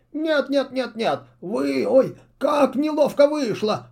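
high, fussy owl voice; a bumbling servant tripping over words; flustered owl. A narrator's reading. Owl babbles excuses before his witch mistress.

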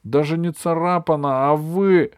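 outraged shout at both friends; boiling over. A narrator's reading. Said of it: "Тут уж Джонни вскипел".

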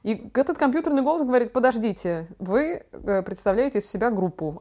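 ordinary delivery

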